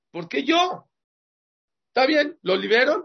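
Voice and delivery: high, whiny protest from the reluctant owner; high indignant voice of the slave owner asked to pay. Acted. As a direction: complain like the man stuck with the bill. Owner forced to free slave complains.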